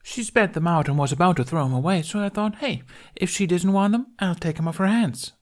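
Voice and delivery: high, fast, gushing babble; rapid excited chatter. A narrator's reading. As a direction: pelt them with words. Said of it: "She was talking so rapidly".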